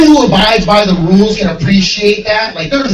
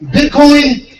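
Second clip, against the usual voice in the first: calling out; 8 semitones higher, 4 dB louder than usual. Real, not acted. Calling out to a mascot in the crowd.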